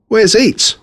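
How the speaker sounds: high worried call for a lost pet; boy calls out in alarm for his bird; anxious cry. Acted. Boy searching the empty cage, calling out anxiously. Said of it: "I cried".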